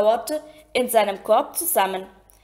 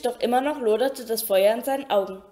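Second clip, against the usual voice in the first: ordinary delivery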